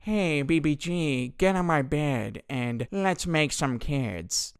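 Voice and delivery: slow, put-on creep voice, slightly softened; mock seduction. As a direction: imitate a sleazy seducer, drawn out.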